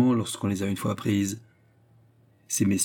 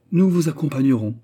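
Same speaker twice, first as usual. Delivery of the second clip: higher, louder, overly keen offer; menacing tenderness; 5 semitones higher, 5 dB louder than usual. A narrator's reading. Agent offering to go upstairs with his host, menacing tenderness. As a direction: insist with alarming affection. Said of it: "avec une tendresse effrayante".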